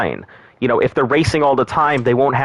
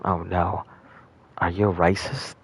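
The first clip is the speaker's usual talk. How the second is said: ordinary delivery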